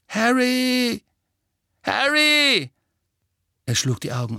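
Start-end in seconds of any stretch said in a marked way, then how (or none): 0.1-2.7 high, loud cry to wake someone; urgent calling. Acted. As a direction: call out urgently to a dreaming friend. Friend calls to wake dreamer. (dringend, laut gerufen)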